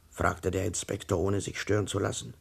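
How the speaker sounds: ordinary delivery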